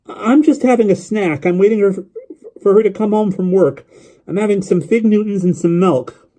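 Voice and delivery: put-on high boy's voice, running on eagerly; young boy rambling on the phone; chattering lonely boy. A narrator's reading.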